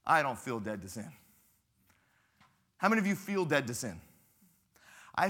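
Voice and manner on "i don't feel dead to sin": low, mock-skeptical voice; lightly voiced doubter; doubting congregant. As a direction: voice a grumbling listener. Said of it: "some of you are like".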